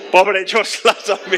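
laughing pity, slow; riendo. Real, not acted. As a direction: chuckle through the words. Se ríe del actor olvidado. (riendo con lástima)

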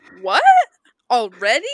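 loud mock surprise; hammed-up disbelief, each word punched out separately; Neil Lane. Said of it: "And he's like".

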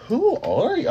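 high-pitched incredulous question; mock-astonished, pitch leaping high. Taken aback by bossy newcomer.

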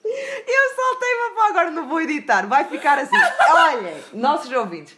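laughing at own mistake. Real, not acted. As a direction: giggle through the admission. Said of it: "esqueci-me".

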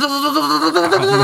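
high, stretched-out startled cry; yelping in shock as the machine starts. Retold or imitated. Startled by face massage machine.

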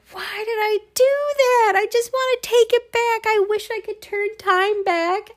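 very high, wailing whine of regret; anguished regret. Retold or imitated. Wishing she could undo scraping the truck, very high wailing whine. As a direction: plead with yourself to undo it, squeaking.

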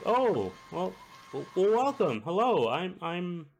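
high, flustered woman's welcome; high-voiced cook caught by surprise. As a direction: greet the new bosses in a high, stammering voice. Cook flustered meeting her new bosses.

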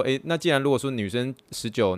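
usual speaking voice

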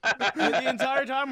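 loud laughing retelling. Real, not acted.

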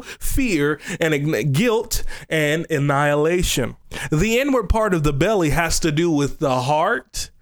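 usual speaking voice

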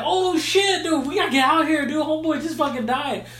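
hammed-up get-out-of-here alarm; mock panic. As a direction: scramble away from the fight. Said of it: "the other monkey's like".

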